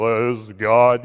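deep, slow, booming mock God; mock-divine proclamation, drawn out and loud. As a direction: put on a big low voice of God. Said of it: "what's it sound like".

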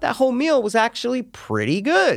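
high, airy put-on voice of a satisfied diner; pleasantly surprised diner. Diner praising the meal after dessert, high and airy. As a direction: sound like someone pleasantly surprised by a whole meal. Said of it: "and you go".

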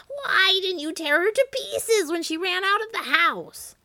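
high, screeching witch holler; enraged witch's screeched accusation; Baba Yaga. A narrator's reading. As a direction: holler furiously, very high and loud. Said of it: "she hollered".

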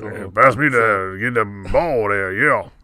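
loud, garbled mock Cajun coach. Co-host parodies a Louisiana coach at practice, garbled impression. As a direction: mumble it like a caricatured bayou coach. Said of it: "trying to play for Coach O".

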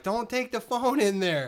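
high, drawn-out wifely scolding; mock spousal telling-off; nagging wife. Voices wife's nagging objection.

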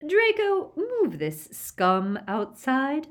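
high, loud, slow command; imperious order. A narrator's reading. Aunt orders Draco to remove prisoners, high imperious command. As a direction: call out the name sharply, then order with contempt.

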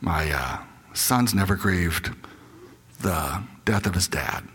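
low, hushed, almost whispering. Retold or imitated. A grieving mother confides in the chaplain, hushed and low. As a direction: drop the voice to a near whisper. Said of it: "she kinda lowers her voice".

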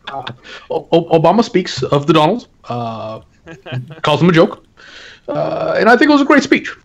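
Obama impression. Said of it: "Yeah, I do impressions".

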